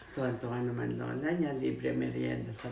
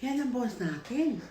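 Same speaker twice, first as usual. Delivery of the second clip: higher, louder, slow imitation of a saleslady; imitating the saleslady; 11 semitones higher, 6 dB louder than usual. Saleslady telling the girl the man is their boss, lightly voiced. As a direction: mimic the salesgirl tipping her off. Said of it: "sabi ng mga tindera".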